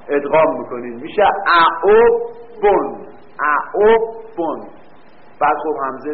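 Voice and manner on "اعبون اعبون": اعبون stretched out slowly, each sound spelled clearly; slow demonstration. Shown as a demonstration. Teacher spelling out the answer slowly for students. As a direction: drag out اعبون so students hear every letter. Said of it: "teacher drawing out the answer".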